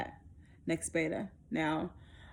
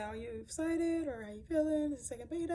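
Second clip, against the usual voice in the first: high, softer questioning voice; nurse imitation; 9 semitones higher, 4 dB quieter than usual. Nurse asking patient about second beta, lightly voiced. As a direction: ask lightly, as the chatty nurse.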